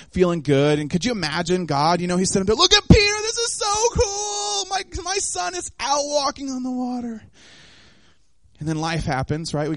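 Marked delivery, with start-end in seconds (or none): 2.6-7.2 high, excited voice of God watching Peter; proud delighted father cheering his son on. Retold or imitated. God delighting in Peter walking on water, high and excited.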